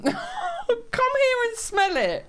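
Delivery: high, drawn-out, through laughter; laughing invitation. Real, not acted. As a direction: squeal it slowly while laughing. Laughing, beckoning about the fart.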